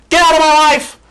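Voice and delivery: high, loud dismissal; exasperated yell. Real, not acted. Vlogger yells at the ex, high and loud. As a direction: throw him out of your life with a shout.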